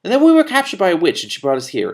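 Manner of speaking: put-on high, loud eager boy voice; a kid bursting with his news; excited young prince. A narrator's reading. Young prince tells the king his adventure, eager and loud.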